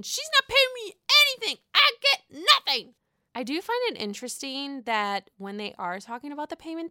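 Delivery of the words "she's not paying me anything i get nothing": high, loud mock Sonja whine; imitating Sonja. Vlogger mimics Sonja complaining about no pay, high and loud. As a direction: squeak it out like a put-upon diva. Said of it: "Sonya chimes in".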